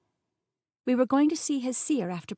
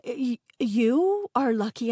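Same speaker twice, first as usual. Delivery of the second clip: stumbling restart on the first word; stuttering; 4 semitones higher, 5 dB louder, slower than usual. A narrator's reading. Narrator covering a blunder to the chef, stuttering. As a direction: trip on "you" before recovering. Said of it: "I stuttered over my words".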